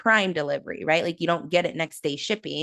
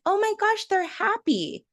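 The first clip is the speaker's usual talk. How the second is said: much higher, gushing coo at a newborn; cooing parent; 13 semitones higher than usual. Retold or imitated. Parent cooing over a crying newborn, high and gushing. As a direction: gush like a delighted new parent.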